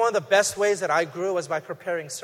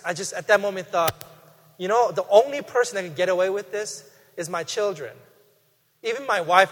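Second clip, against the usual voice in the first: normal talking voice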